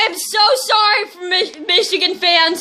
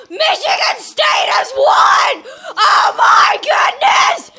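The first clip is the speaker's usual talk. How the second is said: ecstatic shouting; 4 semitones higher than usual. Real, not acted. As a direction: stretch every word of the win like a crying fan. Cheering the replay of the touchdown.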